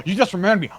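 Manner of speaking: gasp, then high, loud excitement; light-bulb moment, blurted out; sudden realization. Real, not acted. Suddenly remembers he has candy.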